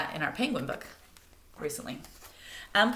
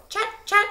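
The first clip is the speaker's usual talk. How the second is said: high, loud bird chatter; sharp high bird call; fieldfare chatter call; 14 semitones higher, 5 dB louder than usual. Retold or imitated. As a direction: chatter a warning. Fieldfares warn.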